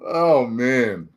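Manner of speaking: usual speaking voice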